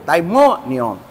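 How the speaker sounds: daimonion said as dai-mo-ni-on, falling at the end; Greek pronunciation. Shown as a demonstration. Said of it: "Olha a palavra grega aí". (daimonion pronunciado em grego)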